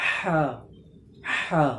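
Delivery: হা pressed from mid throat. Shown as a demonstration. Teacher demonstrating the correct throat ha. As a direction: breathe হা from the throat. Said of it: "এটা হচ্ছে".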